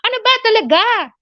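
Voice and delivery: high, fast angry demand. Shown as a demonstration. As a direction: demonstrate how an angry person asks it. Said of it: "with angry, angry, uh, tone of voice".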